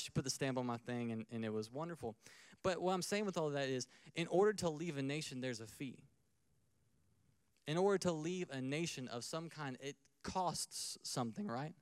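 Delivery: ordinary talk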